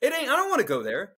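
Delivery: usual speaking voice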